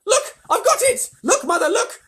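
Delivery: put-on high, loud, tumbling excited boy; excited outburst. A narrator's reading. Boy tells mother he found the ticket, wildly excited. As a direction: gush it all out, tripping over words.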